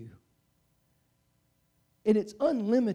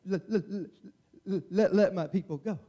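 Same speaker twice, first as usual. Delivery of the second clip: stammering, laughing through it; a light laughing stutter; mock stutter. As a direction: stumble over let, let. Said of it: "I stutter when I talk".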